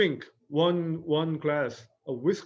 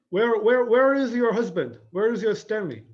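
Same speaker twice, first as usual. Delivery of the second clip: high, raised-voice questions; insistent questioning; 7 semitones higher, 6 dB louder than usual. Acted. Blanche asks Stella where her husband is, insistent. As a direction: ask loudly and urgently, pitched well up.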